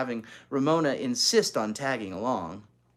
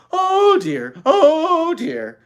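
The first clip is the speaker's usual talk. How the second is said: high, loud wheezing oh dear; harmonica wheeze; 13 dB louder, much slower than usual. Acted. Little girl puffing on her harmonica. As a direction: play it like a harmonica breath. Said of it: "she inhaled and exhaled".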